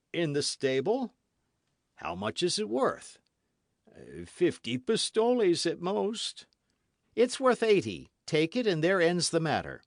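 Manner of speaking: high innkeeper haggling; a sly host naming a price. Acted. Innkeeper haggles over the horse, high.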